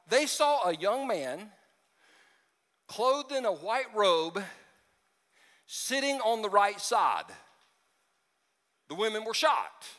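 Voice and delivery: slow, measured Bible reading; slow pulpit reading of Scripture. Acted. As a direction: read the verse slowly and deliberately. Reading scripture slowly aloud.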